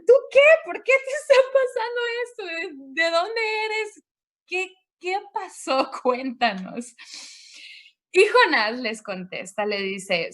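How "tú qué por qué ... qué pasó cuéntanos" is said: high, urgent demands, half laughing; pressing a stranger for answers, pitched way up; agitated sailors. Retold or imitated. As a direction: sailors in the storm grilling Jonah, high and urgent.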